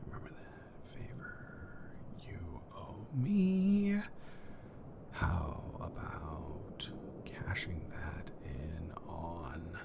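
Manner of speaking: whispered text dictation, unvoiced. Whispering a text to a friend about a favor. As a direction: breathe the words out as you type.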